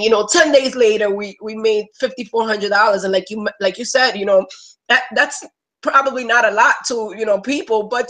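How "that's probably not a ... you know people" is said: usual speaking voice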